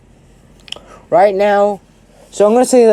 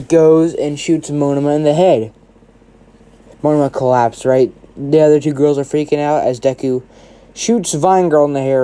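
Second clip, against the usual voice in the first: ordinary delivery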